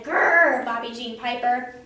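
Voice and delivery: loud growl then her name; mock-fierce kid growl; scary growl. A narrator's reading. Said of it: "I made a scary face at that girl".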